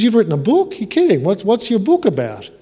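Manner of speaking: high, loud incredulous guest; loud, high mock disbelief; surprised guest. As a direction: scoff in disbelief at a table. Drunk guest's loud surprised retort.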